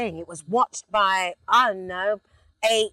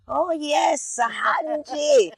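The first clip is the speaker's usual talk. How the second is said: proud mum on the phone; 8 semitones higher, much slower than usual. Retold or imitated.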